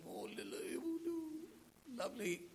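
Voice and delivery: a hint of a doting carer cooing softly; mock fussing coo. Doting babysitter coos over the preacher, lightly voiced, softly. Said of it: "to be fussed about".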